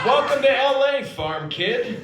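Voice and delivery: mock welcome. Sarcastic mock greeting to himself.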